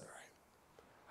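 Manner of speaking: breathless whisper; meek whispered yielding; whispered all right. Retold or imitated. Answering the Lord, a meek whisper.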